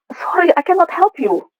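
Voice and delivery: high, slow mimicry of a woman refusing; slow high-pitched brush-off; imitated refusal. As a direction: turn them down in a lifted, unhurried voice. Acts out woman's refusal in English.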